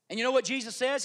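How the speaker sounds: normal talking voice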